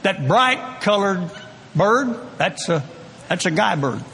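folksy Oklahoma dad, slow and homey; lightly voiced Oklahoma father explaining birds; Oklahoma drawl. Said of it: "my dad in his Oklahoma brogue saying".

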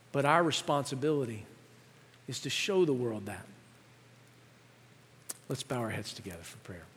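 soft altar call. Acted. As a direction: gently invite them into prayer. Soft close into prayer.

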